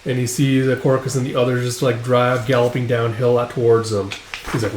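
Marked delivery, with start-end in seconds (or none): none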